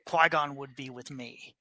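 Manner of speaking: ordinary talk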